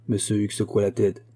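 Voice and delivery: ordinary delivery, nothing marked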